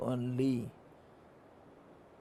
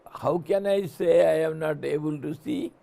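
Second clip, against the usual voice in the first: ordinary talk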